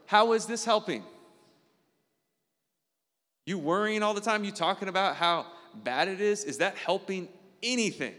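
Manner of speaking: self-scolding. Retold or imitated. Scolding himself for worrying and complaining: exasperated. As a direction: lecture yourself, exasperated.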